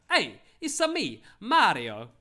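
hammed-up Italian Mario voice, high; a cartoon Italian plumber greeting; Italian accent. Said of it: "Practice your Italian accent".

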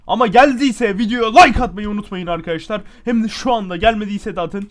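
high, booming appeal; loud like-begging. Real, not acted. Gamer asking viewers for likes, loud and hyped. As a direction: pitch the plea loudly to viewers.

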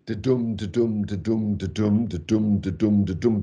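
even, running "da-dum" beats without pauses; regular meter. Shown as a demonstration. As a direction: tick along steadily, weak "da", stronger "dum". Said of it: "not regularly".

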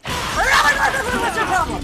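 high, loud panicked yell; loud high shriek of alarm; alarmed scream. Real, not acted. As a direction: scream it at the attacker. Startled, yelling at game figure.

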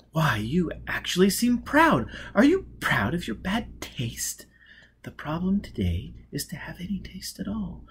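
breathy whispered lines; feed him the line in a whisper; whispered prompt. Acted. Robin whispering the witty answer to Foyle.

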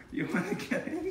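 very high-pitched dog talk; excited high voice for a pet; playful pet talk. Real, not acted. Excitedly teasing his dog.

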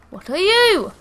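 high, loud startled question; alarmed cry. Real, not acted. Scared player faces an unknown creature, alarmed cry. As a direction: cry out, rising high on the last word.

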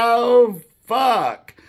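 laughing oath at the memory of the trip; laughing exclamation. Real, not acted. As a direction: blurt it out through the laugh. Laughing exclamation after the punchline.

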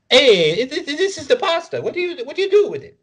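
very high, loud, hammed-up Italian caricature; mock Italian examiner. Mocking an Italy citizenship exam in a high cartoon Italian voice. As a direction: squeal it like a cartoon Italian quizzing a newcomer. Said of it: "a citizenship requirement exam for Italy where it's like".

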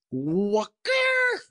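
high, drawn-out cry of disbelief; boyish exclamation. Acted. The boy scoffs at Scrooge's order, exclaiming. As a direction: hoot it in disbelief. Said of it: "exclaimed the boy".

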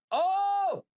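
high, excited shout; yelling. Real, not acted. Streamer reacting to what only they can see, excited yell. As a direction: whoop out a real, startled cheer. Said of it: "I'm just, like, yelling into nothing".

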